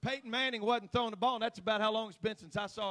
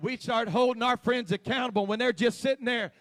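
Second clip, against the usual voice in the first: louder, rising preaching voice; building sermon; 3 semitones higher, 13 dB louder than usual. Acted. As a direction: start pushing the congregation.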